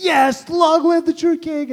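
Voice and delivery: a dad overcome, cheering through tears; mock-tearful triumphant shout. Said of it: "and, like, crying".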